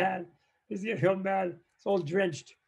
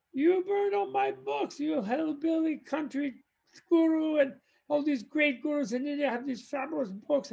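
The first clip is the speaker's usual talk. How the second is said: furious outburst; 10 semitones higher than usual. Acted. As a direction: explode at him over the burned books. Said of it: "he completely flips out".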